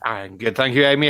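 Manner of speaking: ordinary talk, nothing marked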